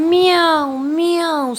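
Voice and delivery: high, loud playful meow; reading the poem, the teacher mews for the cat; cat's meow. Pretend. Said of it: "says the cat softly".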